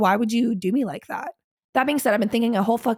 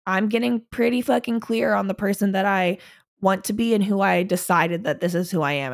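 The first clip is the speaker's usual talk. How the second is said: ordinary delivery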